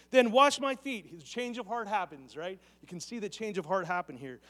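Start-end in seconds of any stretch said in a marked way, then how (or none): 0.1-1.1 eager exclamation. Retold or imitated. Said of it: "Simon Peter exclaimed".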